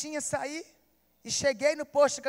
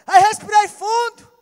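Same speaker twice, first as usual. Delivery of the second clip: slow preaching; 5 semitones higher, 7 dB louder, slower than usual. Acted. Slow emotional recollection, music underneath.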